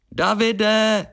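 high, drawn-out cry of a name; joining the call. A narrator's reading. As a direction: call out high for a missing friend. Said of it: "přidala se Mia". (vysoké volání jména do dálky)

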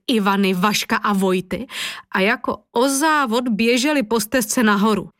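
ordinary delivery, nothing marked

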